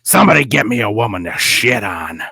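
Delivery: mock exasperated boss impression; frustrated Vince. Joking imitation of the boss after the main event, frustrated. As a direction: put on a frustrated boss demanding relief. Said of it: "getting frustrated and finally just goes".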